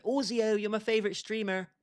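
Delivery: a hint of a suck-up praising another streamer; mocking a fawning fan. Streamer mocking a viewer who fawns over a rival streamer, hint of gushing. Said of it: "Ozio stream going".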